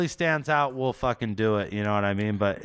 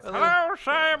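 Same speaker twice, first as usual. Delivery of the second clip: Skeletor-like stomach voice; 14 semitones higher than usual. Pretend. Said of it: "sound like Skeletor".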